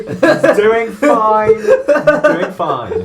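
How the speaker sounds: high, slow, through laughter; laughing. Real, not acted. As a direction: giggle the words out. Repeats while laughing.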